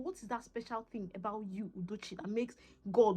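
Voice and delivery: very soft, almost to herself; hushed self-questioning. Retold or imitated. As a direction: drop right down and ask yourself. Asking herself again, addressed by name.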